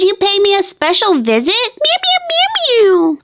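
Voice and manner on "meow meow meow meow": very high little meows; kitten meowing. Acted. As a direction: squeak it like a tiny kitten. Cat answers the girl.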